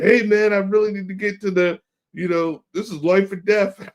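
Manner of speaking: high, loud mock plea of the boss; pleading boss. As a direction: whine it way up like a desperate man. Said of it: "He was like".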